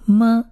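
"么" as an isolated m sound with a short neutral vowel; teacher modelling a lone "么"; letter sound demo. Shown as a demonstration. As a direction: sound out "么" on its own. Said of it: "而这里是发么的音".

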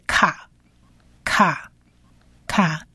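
卡 sounded out as "kha", one syllable at a time; 卡 as an isolated model syllable, k joined to a; k plus short a. Shown as a demonstration. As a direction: repeat 卡 softly with pauses for learners. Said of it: "所以是可加上啊".

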